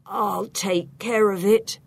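slow, croaky reply. A narrator's reading. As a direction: croak the words out slowly. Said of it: "John replies in a croaky".

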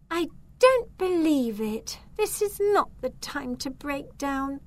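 high-pitched exasperated complaint. A narrator's reading. Zelda stranded on a hill with her broomstick, exasperated. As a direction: complain in high-voiced disbelief.

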